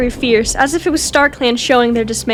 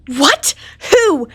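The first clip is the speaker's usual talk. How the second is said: snarled; 4 semitones higher than usual. Acted. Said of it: "she snarled".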